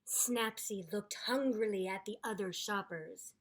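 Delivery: storybook read-aloud voice; story narration. A narrator's reading. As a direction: read it like a picture book for kids.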